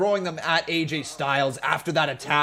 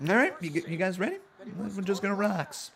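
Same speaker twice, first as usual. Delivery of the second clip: ordinary talk, nothing marked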